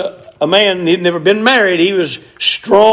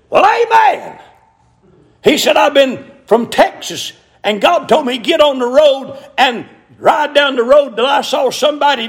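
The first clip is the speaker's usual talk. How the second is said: high, excited testimony in a retold story; acting out an eager traveler; exclaiming stranger; 7 semitones higher than usual. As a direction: burst out high on the amen, keep it raised. Texan stranger acted out, exclaiming.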